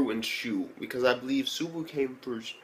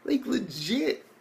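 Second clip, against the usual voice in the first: mock disbelief. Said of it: "And I'm like".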